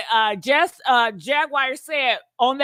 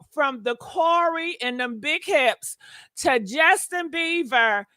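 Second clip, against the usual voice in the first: ordinary talk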